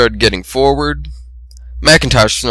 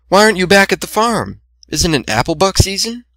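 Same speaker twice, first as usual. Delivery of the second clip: higher gentle shy voice; timid Fluttershy; 9 semitones higher than usual. A narrator's reading. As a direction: explain shyly, pitched up.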